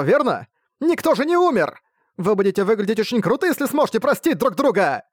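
fierce schoolgirl lecturing class. Acted. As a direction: rail at the class like an outraged class rep. Nadeka pleads and scolds.

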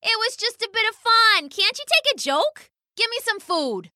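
high, pushy bird voice; dismissive pheasant. Acted. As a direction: brush it off and demand, pitched high. Pheasant dismisses mouse's complaint.

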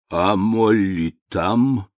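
медленно, тихо, беззубым шамканьем; прошамкай как дряхлый старик; шамкающий старик. A narrator's reading. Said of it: "прошамкал его сиятельство". (шамкающий старый граф)

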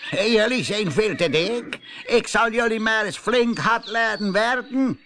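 high, loud, scolding farmer voice; nasty new farmer. Acted. New farmer Teun Kreun orders the pigs to scrub, loud and scolding. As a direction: bark orders at the dirty pigs.

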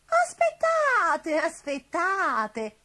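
very drawn-out cry, high then falling; witch calling out. A narrator's reading. Witch stopping the departing friends, calling out. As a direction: call them back with long stretched vowels.

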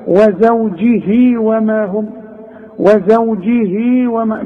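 slow recitation, وزوجه ending in a long "hii"; two-count lengthening. Shown as a demonstration. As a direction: stretch the small ya of وزوجه before وما. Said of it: "مدها حركتين".

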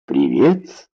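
low, mechanical echo of a greeting; automatic reply. A narrator's reading. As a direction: answer on autopilot, without thinking. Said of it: "автоматически ответил Баннистер". (машинально, на автомате)

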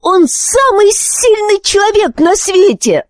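put-on very high, outraged boy's voice; indignant child crying out; furious yell. A narrator's reading. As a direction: defend your father in high-pitched fury. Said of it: "в ярости завопил Зар".

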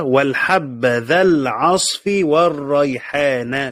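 قراءة متأنية بالنصب; قراءة بطيئة؛ slow, deliberate recitation of the reading. Shown as a demonstration. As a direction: spell out each word slowly for students. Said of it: "بالنصب".

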